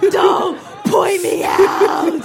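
high, slow, loud protest; Allison's face pleading, shouted; mock shout. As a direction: shout a plea not to be singled out. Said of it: "Her face is like".

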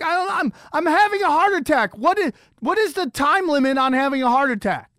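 high, flustered and indignant; stammer, then protest with rising exasperation; hammed-up frantic self-defence; acted panicked protest. Acts out arguing back in panic.

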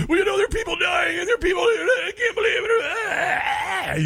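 high shrill wail, slowed, fake crying; mock hysterical critic. Mocking online critics who said people were dying, a high fake-crying wail. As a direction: ham up a panicking critic wailing in tears. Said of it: "I'd get on and I'd say".

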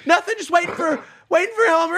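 very high, loud mock voice of the woman. Imitating the woman in the field, high and loud. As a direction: squeak it out like a spaced-out stranger.

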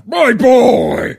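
high excited shout; shouted movie quote. Pretend. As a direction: yell it like a proud mentor. Quoting Hercules film line.

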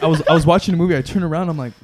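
ordinary talk

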